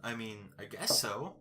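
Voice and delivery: hoarse, worn-out voice; tired, strained throat; losing voice. Real, not acted. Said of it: "my throat is dead".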